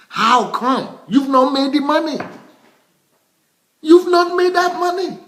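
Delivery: high, loud, incredulous outburst; indignant scolding. Real, not acted. Scolding people living beyond their money, indignant and high-pitched. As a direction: pitch it way up and hold it there, indignant.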